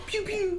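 high, very soft pew-pew; tiny gun noises. Acted. Mocking what French guns sound like, tiny high pews. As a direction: make feeble little squeaky gunshots. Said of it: "they guns probably be like".

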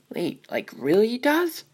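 put-on high, wide-eyed little girl; amazed. Little sister amazed her brother's dragon breathes fire. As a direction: squeak out the wonder.

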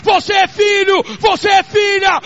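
preaching at full force. Acted. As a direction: proclaim it to the whole church, slow and loud. (pregação gritada, lenta e intensa)

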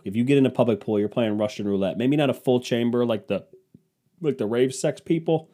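usual speaking voice

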